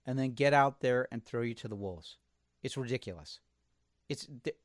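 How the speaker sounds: ordinary delivery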